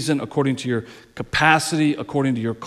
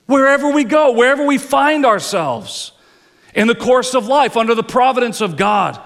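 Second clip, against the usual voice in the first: higher and louder, lifting on go; emphatic slogan; 9 semitones higher, 4 dB louder than usual. Acted. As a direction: proclaim "Wherever we go" as a rallying cry. Slogan repeated with force.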